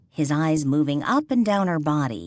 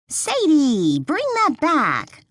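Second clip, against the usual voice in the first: loud, higher call to the dog across the yard; 11 semitones higher, 3 dB louder, slower than usual. A narrator's reading. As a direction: call the dog back loudly. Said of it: "Lily called out".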